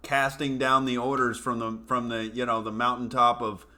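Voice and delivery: ordinary talk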